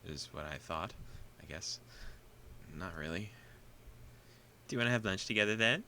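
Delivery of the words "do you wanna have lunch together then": fast, loud, high schoolgirl; eager girl voice. Acted. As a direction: rush out an excited invite. Misha character line.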